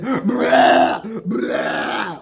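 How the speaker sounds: loud mock vomiting noise; hammed-up puking sound; retching imitation. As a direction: retch it out like someone throwing up. Mimicking girl throwing up.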